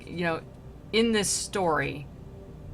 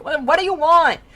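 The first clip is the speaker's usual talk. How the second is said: mock exasperation; 10 semitones higher, 11 dB louder than usual. Paraphrase of the spirit's complaint.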